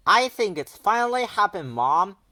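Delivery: yelling to mom. A narrator's reading. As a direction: call out to mom in the next room. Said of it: "he yelled".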